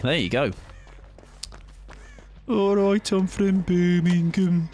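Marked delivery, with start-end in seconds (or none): 2.5-4.7 high, slow Birmingham drawl; Brummie accent. Shown as a demonstration. Streamer repeating his Brummie bit, slow Birmingham accent. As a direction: stretch out the Birmingham vowels. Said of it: "Brummy is still south to me".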